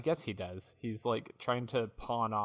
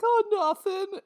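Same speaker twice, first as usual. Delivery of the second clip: very high squeaky denial; fake-innocent pawnbroker; 8 dB louder than usual. Jeeves in pieces denying what happened, squeaky innocence. Jeeves lies about being attacked.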